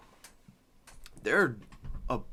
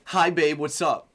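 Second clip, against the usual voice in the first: imitated chummy Siri; 2 semitones higher, 8 dB louder, slower than usual.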